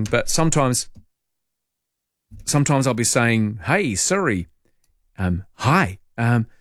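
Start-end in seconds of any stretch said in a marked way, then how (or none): 3.7-6.5 slow and clear. Retold or imitated. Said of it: "read the message out nice and clearly".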